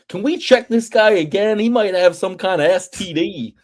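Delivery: lightly mocking Southern accent; crazy Southern accent. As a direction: throw in a Southern twang. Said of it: "You just go Southern".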